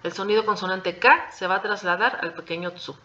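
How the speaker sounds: ordinary talk, nothing marked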